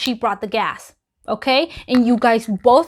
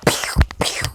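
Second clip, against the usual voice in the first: whispered gun sounds. Retold or imitated. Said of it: "and was like".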